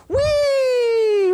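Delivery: high, loud delighted whee; a gleeful lift-off squeal; playful whee. Retold or imitated. God lifting the jumping child, loud high whee. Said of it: "And God goes".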